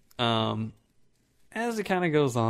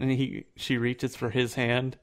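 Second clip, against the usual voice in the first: normal talking voice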